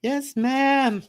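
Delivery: high, sing-song yes ma'am; mock-cute high yes ma'am; playful high voice. Repeated greeting, high far voice.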